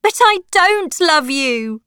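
loud, high angry outburst; shouting. A narrator's reading. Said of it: "I shouted".

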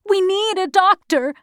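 high-pitched urgent plea; pleading child; desperate insistence. A narrator's reading. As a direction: plead for help, voice pushed high. Child keeps begging officer.

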